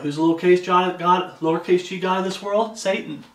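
ordinary talk, nothing marked

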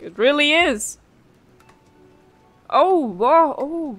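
high excited burst; thrilled, voice jumping up. Real, not acted. Discovers the domain.

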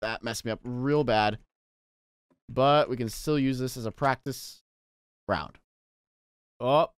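ordinary delivery

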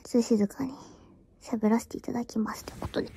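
soft unvoiced whisper; quiet whispered aside. Real, not acted. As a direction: keep it hushed for the phone stream.

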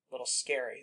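ordinary talk

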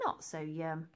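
plain reading voice

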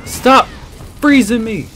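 loud, high yell at the frozen game; frustrated yell. Real, not acted. Gamer yelling at the game freezing him, loud and frustrated.

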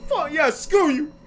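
high, loud squealed tantrum; loud falsetto outburst at someone on screen; angry outburst. Acted. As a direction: shriek back in a high-pitched rage. Mock quarrel with video character.

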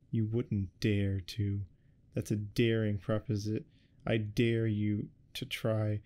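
slow, halting, stumbling over the words; faltering. Acted. Acorn bluffing at his authors, slow and faltering. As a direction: falter through the dare, unsure. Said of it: "Acorn said falteringly".

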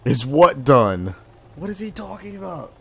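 incredulous. Real, not acted. Mocking confused pilot.

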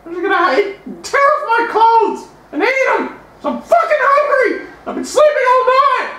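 hungry guy yelling. Retold or imitated. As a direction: rant it like a ravenous man just out of bed. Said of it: "That's what the guy said".

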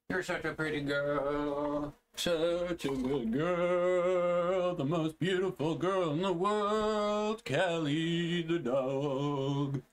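slow high-pitched adoring praise for the dog; doting dog praise. Real, not acted. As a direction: dote on her, stretching each word.

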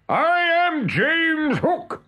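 high, slow, grand proclamation; Hook imitation. A narrator's reading. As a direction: announce yourself as the pirate captain. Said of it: "he answered".

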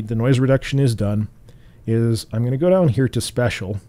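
ordinary delivery

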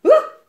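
high, loud startled shriek; a girl shrieking in fright; a sudden high yelp of fright. Acted. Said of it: "Elise gilde".

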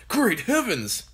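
high, excited father's exclamation; excited cry. A narrator's reading. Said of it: "he cried".

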